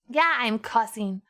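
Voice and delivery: normal talking voice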